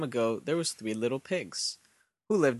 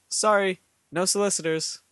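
higher, louder polite brush-off; pig's refusal; 6 semitones higher, 4 dB louder than usual. A narrator's reading. Pigs turning the wolf away at the door, polite refusal. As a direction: pipe it up through the door.

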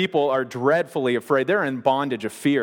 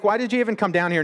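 quick, higher-pitched challenge; challenging; 7 semitones higher, faster than usual. Retold or imitated. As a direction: question his motives in a rush. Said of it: "he's kinda challenging his motives".

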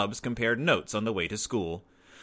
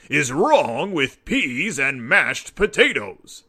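big booming Papa voice, slow and louder; gruff father; 2 semitones higher, 6 dB louder, slower than usual. A narrator's reading. Papa questioning Brother about dinner, booming and slow. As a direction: challenge the complaint in a heavy dad voice. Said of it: "Papa asked".

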